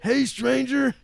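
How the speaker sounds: loud, high hype-man voice; wrestling promo. Retold or imitated. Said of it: "especially not in that voice".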